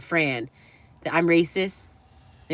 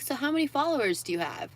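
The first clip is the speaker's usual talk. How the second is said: higher-pitched curious mock question; lightly imitated inquisitive employee, pitched up; imitated store employee; 8 semitones higher, 4 dB quieter than usual. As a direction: ask as a small-talking shop assistant. Retold store employee asking.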